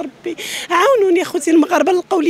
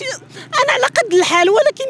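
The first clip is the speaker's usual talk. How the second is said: crying; 7 semitones higher, slower than usual. Real, not acted. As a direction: voice breaking into tears. Mother pleading, children missing.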